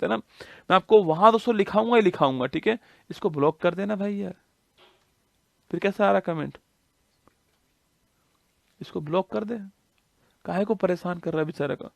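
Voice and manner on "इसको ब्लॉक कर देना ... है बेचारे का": much softer, off-hand aside; quiet aside. Real, not acted. Teacher asking a helper to block a commenter, quiet aside. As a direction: drop the volume and speak off to the side.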